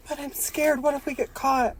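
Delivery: high, soft, scared little voice; timid puppet voice. Acted. Laurent fearing they get caught, high and timid. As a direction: squeak it out nervously. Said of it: "Laurent is like".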